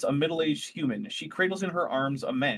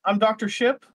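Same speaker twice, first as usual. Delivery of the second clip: nervous doctor voice; 6 semitones higher, 6 dB louder than usual. Acted. Doctor introduces herself, stammering.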